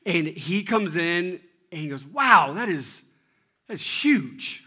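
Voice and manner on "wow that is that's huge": loud, drawn wow, voice lifting; mock-astonished child; imitated amazement. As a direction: act out a kid stunned by the size. Brother sees sister's bigger pancake.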